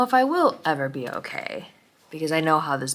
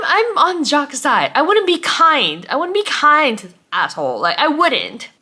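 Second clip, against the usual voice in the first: indignant rant; 8 semitones higher, 5 dB louder than usual. Real, not acted. Ranting about David.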